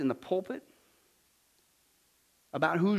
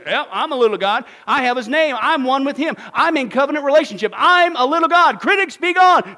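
building to a loud, high mock boast; mock boastful preacher; 7 semitones higher, 11 dB louder than usual. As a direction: ham up the preacher who thinks he is a little god. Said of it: "He says".

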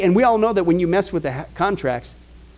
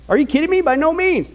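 much higher, fast, laughing mock disbelief; Paul's sarcasm; 9 semitones higher than usual. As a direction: scoff it like it's absurd. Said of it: "You can hear Paul's sarcasm".

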